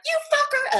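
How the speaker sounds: high-pitched, loud burst at another driver; road rage. Retold or imitated. Driver cursing another car while on the phone, road rage. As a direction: snap into a reenacted road-rage yell. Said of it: "a little bit of road rage".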